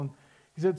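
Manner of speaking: normal talking voice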